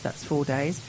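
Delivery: ordinary talk, nothing marked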